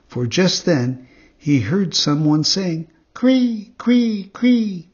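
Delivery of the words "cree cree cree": high, loud chirping cree sounds; cricket chirp. Acted. Cricket calling to Pinocchio, high chirp.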